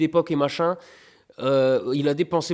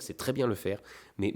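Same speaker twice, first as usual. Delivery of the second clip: usual speaking voice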